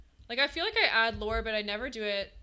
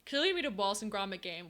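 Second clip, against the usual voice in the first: normal talking voice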